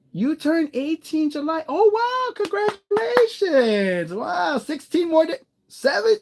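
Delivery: high, bright-eyed congratulations, way up in pitch; excited. Real, not acted. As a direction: gush over the viewer's birthday. Excited birthday congratulations to viewer.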